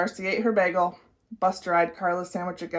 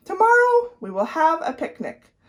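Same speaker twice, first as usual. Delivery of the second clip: cheerful announcement; 8 semitones higher than usual. A narrator's reading. Teacher announcing to class.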